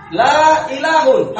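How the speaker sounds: la ilahun said slowly with the tanwin ending un; wrong reading shown. Shown as a demonstration. Teacher slowly showing students the wrong reading la ilahun. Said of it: "kenapa setelah la itu ilah tidak dibaca ilahun".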